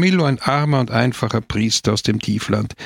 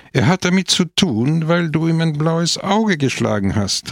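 higher-pitched, emphatic outburst; exclaiming; 6 semitones higher than usual. A narrator's reading. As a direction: exclaim it as an indignant reminder. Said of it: "rief Don Camillo aus".

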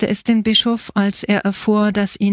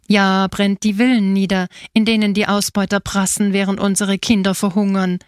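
ordinary delivery

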